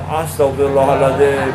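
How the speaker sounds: a hammed-up pious sigh of repentance; mock pious. Mocking a thief who prays while stealing, hammed-up piety.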